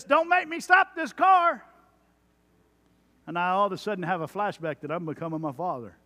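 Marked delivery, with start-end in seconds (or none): 0.0-1.6 high, loud fatherly warning; dad threat. Retold or imitated. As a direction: threaten the kids from the driver's seat. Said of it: "Or I'll say things like this".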